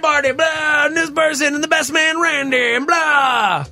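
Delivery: high, projected announcer call with filler words; wedding DJ announcer. Retold or imitated. Host imitating DJ announcing the wedding party, hyped announcer voice. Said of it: "he's announcing the wedding party".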